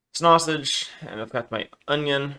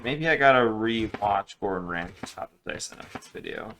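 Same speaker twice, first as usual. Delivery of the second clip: ordinary talk, nothing marked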